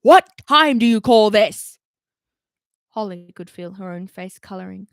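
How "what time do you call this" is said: louder, slow angry telling-off; scolding. Acted. Root scolding Holly for lateness, loud and slow. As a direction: scold her word by word. Commander scolding late officer.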